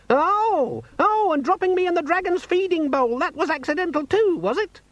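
very high, squeaky sarcastic outburst; indignant demon. A narrator's reading. Demon disputing owner's excuse, sarcastically indignant. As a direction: pile on the accusation in a squeak.

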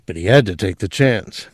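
ordinary delivery

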